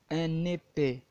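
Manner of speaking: épais with a closed, bright é; teaching pronunciation. Shown as a demonstration. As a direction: say épais slowly, clear closed é. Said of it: "شوف النطق، اسمع النطق".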